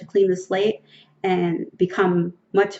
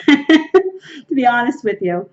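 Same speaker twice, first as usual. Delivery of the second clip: laughing; 4 semitones higher, 6 dB louder, slower than usual. Real, not acted. Laughs at own irritability.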